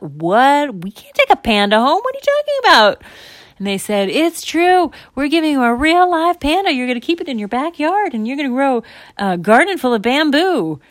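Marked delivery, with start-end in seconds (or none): 0.0-3.0 loud, rising disbelief; incredulous parent. Acted. Parents told they won a panda, incredulous. 4.1-10.8 high, loud, excited announcing; prize announcer. Acted. Volunteer confirming panda prize to parents, announcing.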